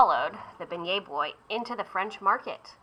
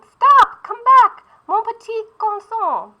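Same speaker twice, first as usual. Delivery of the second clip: much higher, much louder desperate call; shouting; 12 semitones higher, 9 dB louder, slower than usual. Acted. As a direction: yell after the runaway pastry.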